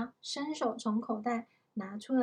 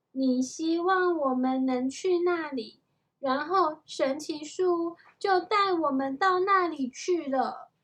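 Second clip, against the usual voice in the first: eager explaining; 4 semitones higher, 5 dB louder than usual. A narrator's reading. Annie explains eagerly in story.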